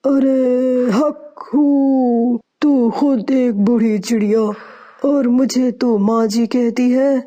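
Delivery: put-on old witch speaking in a mannish voice, opening call drawn out slowly; man's voice. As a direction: sound like a man though playing a witch. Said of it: "آپ کی آواز تو ایک دم مردوں والی ہے".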